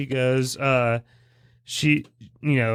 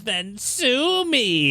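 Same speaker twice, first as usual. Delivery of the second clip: higher, very drawn-out taunt; mock villain retort; 8 semitones higher, much slower than usual. Quoting the villain answering the kids, mock defiant. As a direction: stretch it out like a hammy villain.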